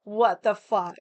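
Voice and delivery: loud, sharp outraged what the fuck; blurting it out in disbelief. Real, not acted. Learning of the brother's secret wedding, loud outrage.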